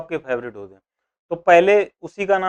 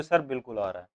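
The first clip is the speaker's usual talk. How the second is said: student reply; 6 semitones lower, 9 dB quieter than usual. Retold or imitated. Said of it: "आप कहोगे".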